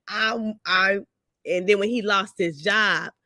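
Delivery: ordinary delivery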